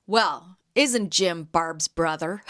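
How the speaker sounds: ordinary talk, nothing marked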